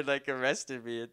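usual speaking voice

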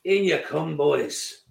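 menacing welcome. Pretend. Manager greeting players at the dressing room door, mock menacing. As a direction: greet them like a threat waiting by the changing room.

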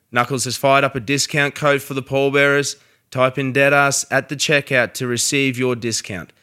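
upbeat sponsor read, loud. Acted. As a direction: read the sponsor spot with punch.

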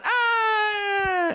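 long high-pitched "ah" squeal; high sustained mock scream; running kid's scream. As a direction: squeal like a kid being chased. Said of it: "And then they run".

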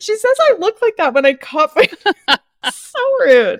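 mock offence through laughter; laughing, scandalized by her sister's rudeness; laughing outrage. Real, not acted. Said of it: "Have you ever heard of anything ruder".

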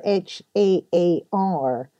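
haar spelled slowly, H A A R; slow spelling. Shown as a demonstration. Explaining the Scottish sea mist, slow spelling. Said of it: "Or har".

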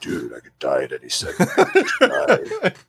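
half-laughed, wry dark thought; laughing at himself. Retold or imitated. Driving alone and spiralling, he laughs at the thought of dying. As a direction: laugh through the grim idea.

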